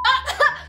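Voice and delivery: sudden high laugh. Real, not acted. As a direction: burst out laughing. Laugh after taunt.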